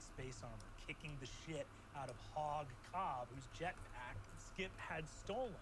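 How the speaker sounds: calm storytelling narration; game master recap. Acted. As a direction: set the scene like a game master.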